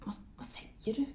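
unvoiced hushed question; a secretive whispered what. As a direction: whisper it so nobody hears. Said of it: "så viskar hon".

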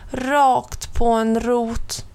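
plain reading voice

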